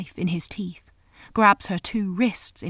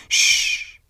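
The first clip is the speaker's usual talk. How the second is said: put-on sharp hiss to hush the children; hushing shh; 7 dB louder than usual. A narrator's reading.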